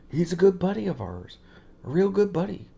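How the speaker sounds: high, loud folksy praise; hammed-up George Bush Senior. A father praising Rob to his son. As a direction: overdo the fond southern buddy tone. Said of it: "Well, boy".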